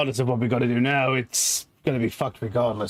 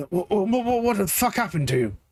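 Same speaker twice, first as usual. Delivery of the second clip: higher, rushed and stammering; panicked brother; 7 semitones higher than usual. Acted. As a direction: demand answers in alarm. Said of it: "runs up to him and is like".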